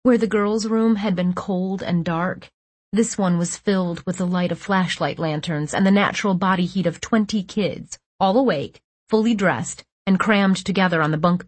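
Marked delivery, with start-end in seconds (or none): none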